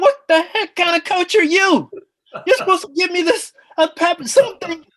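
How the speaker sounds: high, loud, incredulous rant; indignant. Retold or imitated. Retold rant at the coach who walked away, high and indignant.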